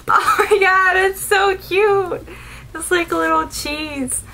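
high, gushing delight over something cute; delighted squeal. Real, not acted. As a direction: squeal it, stretching the high notes. Delighted by a tiny cheese.

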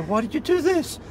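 high, whining complaint; a pleading, exasperated why; exasperated whine. Retold or imitated. Exasperated question to wife.

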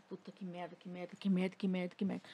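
low-volume repeated cursing under the breath; retold seething mutter; muttered swearing. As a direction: curse softly to yourself on a loop. Reencena xingamento para si mesma.

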